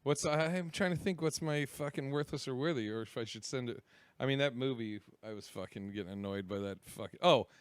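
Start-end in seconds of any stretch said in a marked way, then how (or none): none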